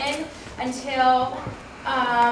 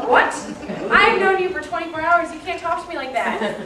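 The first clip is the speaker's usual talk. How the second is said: indignant shock; 6 semitones higher, 4 dB louder than usual. Retold or imitated. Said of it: "I was like".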